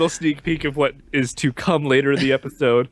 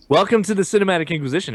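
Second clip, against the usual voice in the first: podcast host intro; 7 semitones higher, faster than usual. Acted. Show intro.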